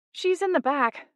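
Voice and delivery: reply with a flourish of irritation; irritated. A narrator's reading. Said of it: "a flourish of irritation in her voice".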